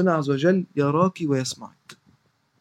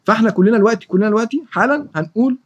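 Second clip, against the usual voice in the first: ordinary delivery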